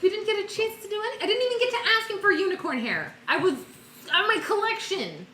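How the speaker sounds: high, fast, upset outcry; excited. Real, not acted. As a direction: blurt it out, dismayed. Upset unicorn left too soon.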